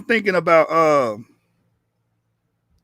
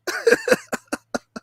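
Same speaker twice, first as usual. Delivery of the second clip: high cackle. Real, not acted. Laughs at his own taunt.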